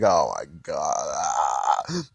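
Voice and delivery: low, slow, stunned groan of disbelief; dismayed Gohan. Retold or imitated. Gohan watching Kai go down, low and slow dismay. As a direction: drag it out, stunned and worn. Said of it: "you have Gohan is like".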